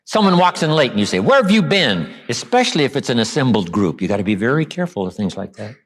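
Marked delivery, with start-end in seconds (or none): 1.2-2.1 high-pitched accusing demand; pointed, sarcastic challenge to a latecomer; loaded question. Shown as a demonstration. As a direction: confront the latecomer, pitch jumping up. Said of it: "See, that's loaded".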